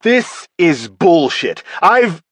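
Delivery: slow, loud angry snarl rising high. Acted. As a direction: spit it out, outraged at the accusation. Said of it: "Tull snarled".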